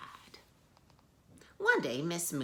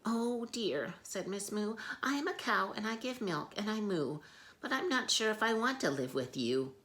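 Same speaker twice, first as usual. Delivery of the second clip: higher, worried cow voice; nervous cow; 7 semitones higher than usual. Acted. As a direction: answer timidly. Frightened cow answers.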